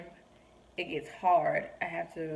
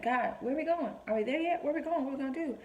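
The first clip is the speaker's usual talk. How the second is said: high, pestering mock-anxious questions; childlike anxiousness; 8 semitones higher, faster than usual. Anxious believer pestering God like a child, high mock worry. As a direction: nag like an anxious kid on a car ride. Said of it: "same kind of anxiousness".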